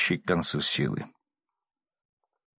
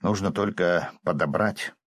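ordinary delivery, nothing marked

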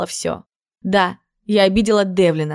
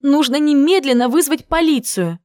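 higher-pitched, urgent exclamation; alarmed urging; 6 semitones higher, 3 dB louder than usual. Acted. Friend insisting on calling the police, alarmed and urgent. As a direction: urge it in alarm, pitch raised.

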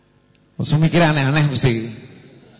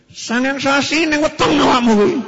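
higher, louder scolding mother; hammed-up scolding mum; angry mother; 9 semitones higher, 3 dB louder than usual. Mother berates her child about nine months carrying him, higher scolding. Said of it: "ibunya lebih ngeri lagi".

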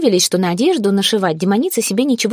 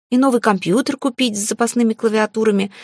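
ordinary delivery, nothing marked

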